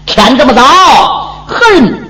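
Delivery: booming voice. Acted. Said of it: "一声浑厚的声音".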